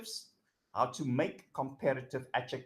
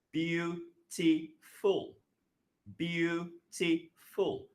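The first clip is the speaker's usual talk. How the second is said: "beautiful" stretched very slowly, syllable by syllable; slow syllable demo; 2 semitones higher, much slower than usual. Shown as a demonstration. Teacher slowing "beautiful" so students hear its syllables. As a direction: draw out "Beautiful" so each syllable stands apart. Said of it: "Three syllables".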